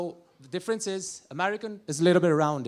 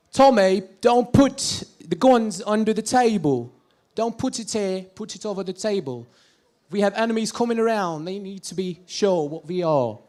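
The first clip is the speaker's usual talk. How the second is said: Arthur Shelby; 2 semitones higher, 6 dB louder than usual. Acted. Comedian as Peaky Blinders gangster warning Tommy, British gangster impression. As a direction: bark it in a Birmingham accent. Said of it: "sentence which Arthur Shelby said".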